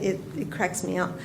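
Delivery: ordinary talk, nothing marked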